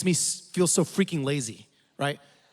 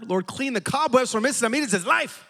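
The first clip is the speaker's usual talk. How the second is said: higher-pitched, intensified mock prayer; harder, fervent prayer; 8 semitones higher than usual. Pastor praying harder for the old lady, high mock-fervent pitch. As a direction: pray it with comic extra fervour. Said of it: "prayed a little harder".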